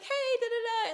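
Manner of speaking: high-pitched singsong mock greeting; bubbly girl-group hello trailing into da-da filler; excited greeting. Imitating girls meeting at a club table, excited.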